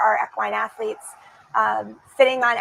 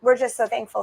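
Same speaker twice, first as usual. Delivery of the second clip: ordinary talk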